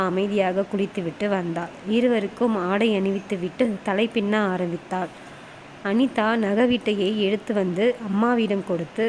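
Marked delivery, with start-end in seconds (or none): none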